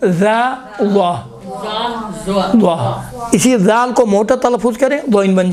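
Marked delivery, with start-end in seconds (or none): 0.1-3.0 زا thickened into a heavy ظا, drawn slowly; teacher turning زال into a heavy ظا. Shown as a demonstration. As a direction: say زا slowly with a heavy full tongue. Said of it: "دوئین بن جائے، دیکھیں".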